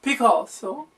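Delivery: put-on very high, hammy French accent on Picasso; a terrible fake French accent, overdone; worst French accent. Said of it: "the worst French accent ever".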